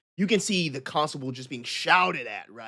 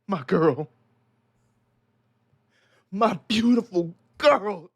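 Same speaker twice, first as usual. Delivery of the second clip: grieving father; 6 semitones higher, 4 dB louder than usual. Acted. Grieving father telling the mob about his daughter, anguished rise. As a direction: climb into anguish over his girl. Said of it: "He starts to break down".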